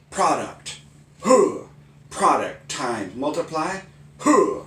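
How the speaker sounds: loud terms each with a high karate huh; karate chant. Shown as a demonstration. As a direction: shout each word then grunt like a karate strike. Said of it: "karate math".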